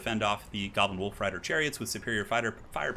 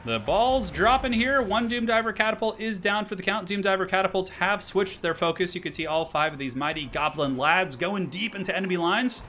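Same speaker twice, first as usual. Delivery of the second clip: higher and louder, hyped caster call; excited play-by-play; 7 semitones higher, 3 dB louder than usual. Real, not acted. Caster hyping the viewers as the armies collide, excited. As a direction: call the clash like it just kicked off. Caster calls battle opening.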